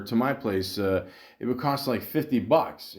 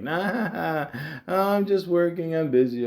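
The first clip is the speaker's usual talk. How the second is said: higher-pitched, laughing imitation of her brush-off; mimicking her; 6 semitones higher, slower than usual. Woman brushing off a spy accusation, laughingly mimicked.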